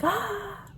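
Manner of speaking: high, soft "ah"; airy "ah". Retold or imitated. Mimicking her oblivious self walking around, airy high "ah". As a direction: let out a light, high "ah".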